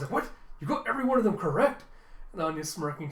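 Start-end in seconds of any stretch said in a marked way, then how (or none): 0.1-1.8 loud, high, fast disbelief; play-acted shocked outburst; surprised. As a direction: blurt it out in astonishment. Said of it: "you see Yuri just surprised".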